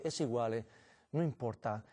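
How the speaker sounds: a low, hushed devil shrugging it off; sneering devil. Retold or imitated. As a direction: murmur it low like the mocking devil. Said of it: "se ríe y dice".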